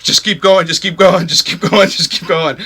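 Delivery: yelling. Retold or imitated. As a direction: keep shouting it, pushing yourself forward. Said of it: "I'm yelling and I'm yelling".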